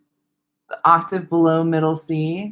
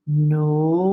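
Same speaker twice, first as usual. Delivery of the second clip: gliding no. Shown as a demonstration. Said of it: "So doing that just linear".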